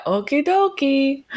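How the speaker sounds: high cartoon Luigi voice. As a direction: chirp it like Luigi. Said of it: "he says".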